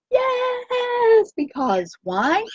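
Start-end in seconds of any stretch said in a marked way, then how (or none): 0.1-1.3 high shouted yes; triumphant yell after the chant; excited cheer. Real, not acted.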